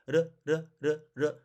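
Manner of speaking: 르 said as short separate taps; quick, light R tap in 르; tapped R. Shown as a demonstration. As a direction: flick the tongue once for each 르. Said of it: "이렇게 탄서름이기 때문에".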